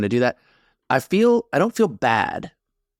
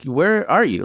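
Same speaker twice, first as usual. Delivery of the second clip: snapping; 7 semitones higher, slower than usual. Retold or imitated. Retelling snapping at his wife over the paused show, sharp and irritated. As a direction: snap it out impatiently. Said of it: "I'm snapping".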